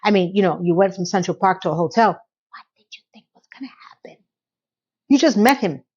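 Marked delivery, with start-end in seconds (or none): 2.1-4.2 hushed, disbelieving whisper; whispered aside. Real, not acted. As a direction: whisper the pointed question. Whispered aside judging the character.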